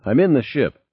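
ordinary delivery, nothing marked

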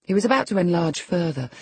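plain reading voice

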